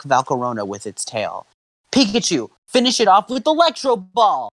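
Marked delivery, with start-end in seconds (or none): 1.9-4.5 high, forceful finishing command; instructing. Acted. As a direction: call for the final blow. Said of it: "Ash instructed".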